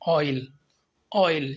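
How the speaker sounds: ऑयल said slowly, gliding from a short a toward i; oi glide demo. Shown as a demonstration. Teacher showing students the oi diphthong, deliberate glide. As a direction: model ऑयल with a clear glide. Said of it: "अ की तरफ हमने glide किया".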